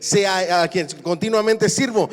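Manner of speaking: loud, rising preacher's cry; fiery preaching. Acted. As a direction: preach it out to the whole church. Preaching at full force.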